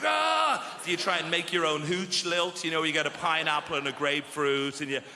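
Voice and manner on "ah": mock anguish cry.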